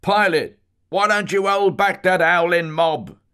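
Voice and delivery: high, loud Cockney preacher's cry; hammed-up Cockney sermon shout, pitched high; Father Bernard Vaughan's Cockney. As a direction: thunder it like a Cockney preacher from the pulpit. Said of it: "droll eyes and cockney voice".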